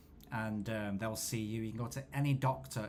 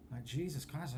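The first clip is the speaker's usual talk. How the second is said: ordinary delivery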